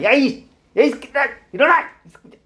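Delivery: teacher acting out a swearing yell as a bad example; mock abusive yell. Shown as a demonstration. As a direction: bark out the insult like a fed-up bully. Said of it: "이렇게 욕하면은".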